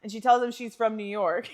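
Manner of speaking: ordinary talk, nothing marked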